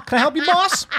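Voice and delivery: high, loud helpful-clerk voice; store clerk. Acted. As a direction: call out eagerly to a customer. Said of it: "He went to Home Depot. He's like".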